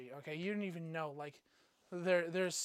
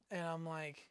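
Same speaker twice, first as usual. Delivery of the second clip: normal talking voice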